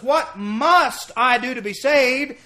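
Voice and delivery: slowed down, leaning on must; slow weighty question. Shown as a demonstration. Preacher stressing must to the church, slow and weighty. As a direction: make every word of the question count.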